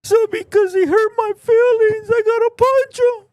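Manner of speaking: whiny mock voice rising high and loud; sarcastic crybaby impression; mocking whine. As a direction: mimic a grown man sulking, pitch climbing. Mocking a whiny grown man.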